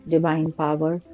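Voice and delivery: ordinary delivery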